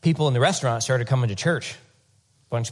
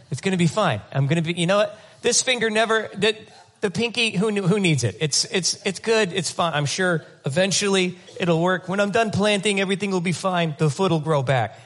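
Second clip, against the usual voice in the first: higher, breezy make-believe denial; mock stubborn husband; 6 semitones higher than usual. Husband shrugging off his wife's nagging, mock carefree. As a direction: brush it all off like nothing's wrong. Mocking a husband avoiding doctor.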